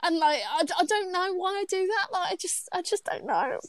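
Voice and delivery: high, singsong mock British accent; fake British accent. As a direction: sound like an American attempting British. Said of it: "someone that's American trying to be British".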